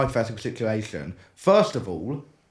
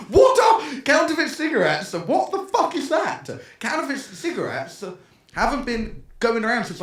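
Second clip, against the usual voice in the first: furious shout; 8 semitones higher than usual. Retold or imitated. Dad phones the school back, shouting in outrage. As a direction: blast the question at them, outraged. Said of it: "He was fuming".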